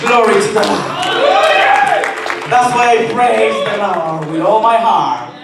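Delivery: high, ringing, shouted preaching; full-force preaching. Real, not acted. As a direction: drive the promise home at the top of your range.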